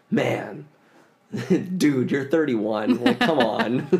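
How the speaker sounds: exasperated at yourself, breaking into laughter; laughing self-scolding. Retold or imitated. Storyteller mocking themselves over the cat talk, laughing self-reproach. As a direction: chide yourself, laughing through the words.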